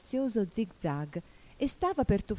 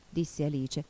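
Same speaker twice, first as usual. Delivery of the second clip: ordinary delivery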